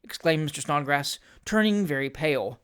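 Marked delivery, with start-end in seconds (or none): none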